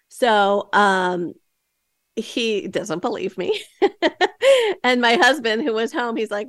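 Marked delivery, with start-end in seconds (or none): 3.7-4.8 high bursting laugh; burst of laughter. Real, not acted. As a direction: laugh out loud at the memory. Laughing while telling story.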